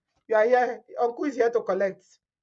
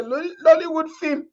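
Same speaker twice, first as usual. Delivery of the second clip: high, breaking laugh on the words; barely getting it out through laughter; 6 semitones higher than usual. Real, not acted. Vlogger to viewers, losing it with laughter.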